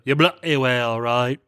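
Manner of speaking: mock British accent pushed further; exaggerated British accent. Husband exploring his accent further, exaggerated British accent. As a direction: lean harder into the fake British accent. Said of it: "kept exploring my accent further and further".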